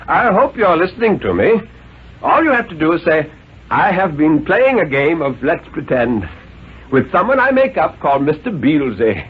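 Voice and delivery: a lightly voiced stern, reasoning father; a hint of a condescending father; father's voice. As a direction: coax the boy like a patient but superior dad. Father lectures the boy.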